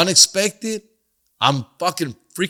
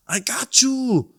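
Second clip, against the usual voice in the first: neighbor's voice; 7 semitones higher, much slower than usual. Retold or imitated. Neighbor reassures him.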